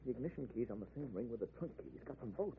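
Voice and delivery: hushed. Acted. Len telling his wife about the keys in a hushed voice. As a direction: keep it low so he can't hear. Hushed talk, killer nearby.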